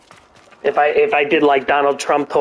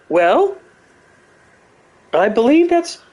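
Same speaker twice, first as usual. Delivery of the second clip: high, musing professor voice; a lecturer weighing his words, pitched up; a higher-voiced, musing Jordan Peterson; Jordan Peterson impression; 7 semitones higher than usual. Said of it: "Jordan Peterson would come in and be like".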